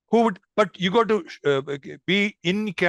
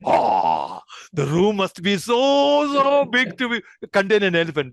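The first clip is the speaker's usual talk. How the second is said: playful amazement. Pretend. Teacher reacts with mock amazement.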